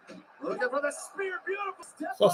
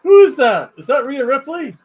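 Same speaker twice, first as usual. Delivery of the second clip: excited; 7 semitones higher, 7 dB louder than usual. Real, not acted. Masked figure revealed mid-match.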